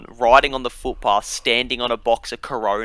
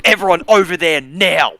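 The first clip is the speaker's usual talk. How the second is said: loud, higher barked order; cop shouting orders; 7 semitones higher, 8 dB louder than usual. Retold or imitated. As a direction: command the whole group.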